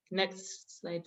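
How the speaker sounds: ordinary talk, nothing marked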